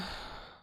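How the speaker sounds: quiet breathy exhale; soft tired sigh. Acted. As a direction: sigh as if sinking back down. Unwell, lying in bed.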